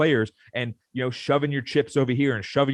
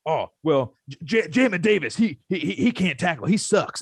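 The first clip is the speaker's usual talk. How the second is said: mock coach; 4 semitones higher than usual. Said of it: "It's always".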